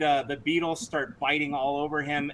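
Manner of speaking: ordinary talk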